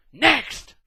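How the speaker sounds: a loud, high called next; loud retort. Acted. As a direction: bark it as a comeback. God retaliating in the game.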